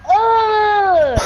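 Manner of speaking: loud, high disgusted groan; disgusted ugh. Retold or imitated. Mom sees the dirty water.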